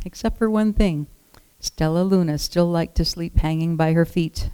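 plain reading voice

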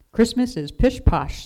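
loud, high-pitched gruff dismissal; forceful, grumpy scoff. A narrator's reading. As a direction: dismiss it emphatically, like a grumpy woodcarver. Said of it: "said Jonathan gruffly".

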